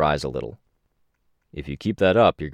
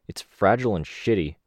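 plain reading voice

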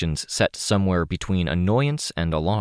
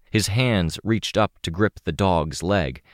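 plain reading voice

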